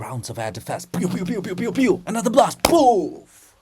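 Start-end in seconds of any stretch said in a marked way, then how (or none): 0.9-2.0 rising pew pew gun sounds; playful air defense pews; mock gunfire. 2.1-3.5 high loud poof of a blast; cartoon explosion poof; mock explosion. Explosion after air defense.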